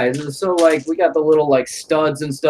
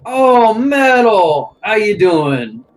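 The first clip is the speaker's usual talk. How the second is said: higher, louder, slow and gushing; excited Goff; 7 semitones higher, 5 dB louder than usual. Acted. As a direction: greet him like a long-lost buddy. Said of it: "really thick Bostonian accent".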